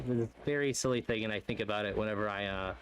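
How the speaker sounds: normal talking voice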